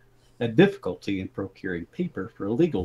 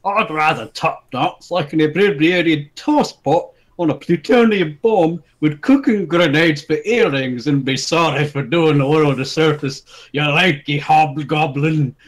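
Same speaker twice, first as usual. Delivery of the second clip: brawler's insult; 5 semitones higher, 5 dB louder than usual. Acted.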